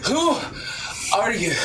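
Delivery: winded, halting question; acting winded, words split by pauses; out of breath. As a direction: break the words apart to catch breath. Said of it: "Also out of breath".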